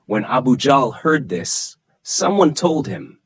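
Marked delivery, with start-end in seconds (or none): none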